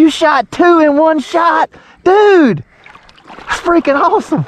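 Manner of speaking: high, drawn-out thrilled disbelief; amazed. Real, not acted. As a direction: react stunned and overjoyed. Father amazed at child's shot.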